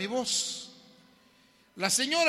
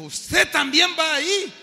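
put-on loud, high incredulous young man; a loud scoffing street kid; scoffing stranger; 10 semitones higher, 11 dB louder, slower than usual. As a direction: toss it off with disbelief. Stranger voiced in sermon story.

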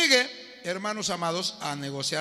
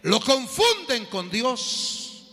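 loud, slow, emphatic sermon delivery; fiery preaching; 4 semitones higher, 3 dB louder, slower than usual. Acted. Preacher rebuking those who boast of knowing God, loud and emphatic. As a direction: hammer each point home to the congregation.

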